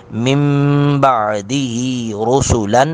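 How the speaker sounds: مِن بَعْدِهِ with نون turned to میم; iqlab. Shown as a demonstration. Teacher demonstrating iqlab. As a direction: say مِن بَعْدِهِ as mim-ba'dihi. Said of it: "نون ساکن کو میم سے بدل کر".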